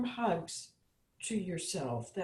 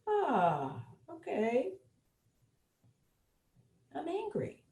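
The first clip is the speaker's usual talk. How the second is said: gentle acknowledgment; 5 semitones higher, slower than usual. Shown as a demonstration. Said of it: "and say".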